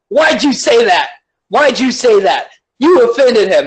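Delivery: high-pitched, scolding outrage; whiny, hammed-up telling-off; mock indignant yelling. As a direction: play an overwrought scold, pitch jumping up. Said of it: "'cause you're yelling at me".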